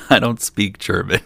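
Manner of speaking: laughing at your own poor German; a small laugh breaking into the words; amused admission. Real, not acted. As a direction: say it through a chuckle. Chuckling at own bad German.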